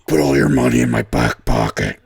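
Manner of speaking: very low gravelly Batman growl. Acted. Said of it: "have a Batman voice".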